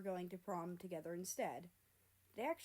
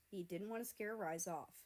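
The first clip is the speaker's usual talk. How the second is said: ordinary delivery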